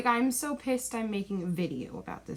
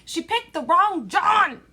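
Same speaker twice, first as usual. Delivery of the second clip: outraged shout; 8 semitones higher, 8 dB louder than usual. Real, not acted. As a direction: yell it at the screen in disbelief. Ranting outrage at the movie ending.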